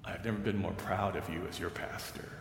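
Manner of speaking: a quiet echo of the pastor's pride; hushed repeat. Retold or imitated. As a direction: let the words sink in, softly. Same words repeated softly.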